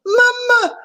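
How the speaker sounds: very high frightened cry; crying for mama. A narrator's reading. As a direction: wail for his mother. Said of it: "cried Abu".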